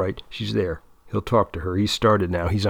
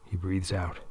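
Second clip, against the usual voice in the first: plain reading voice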